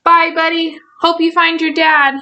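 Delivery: high character voice, held up throughout; cheery farewell call. Acted. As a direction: call goodbye in a high little voice. Character calls farewell to Buddy.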